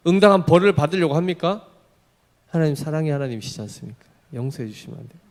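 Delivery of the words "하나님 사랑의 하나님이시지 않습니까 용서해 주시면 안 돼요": soft, quick wheedling plea; mock pleading. Preacher imitating a believer asking God for forgiveness, meekly pleading. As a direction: plead under the breath, hurriedly.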